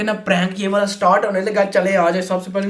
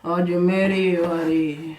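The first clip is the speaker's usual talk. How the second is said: fake drunk; much slower than usual. Drunk son talking back.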